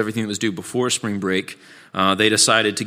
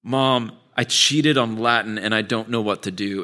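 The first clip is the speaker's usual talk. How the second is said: normal talking voice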